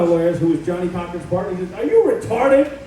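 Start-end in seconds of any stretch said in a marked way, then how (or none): 1.7-2.8 incredulous lawyer. Retold or imitated. Lawyer reacts in disbelief.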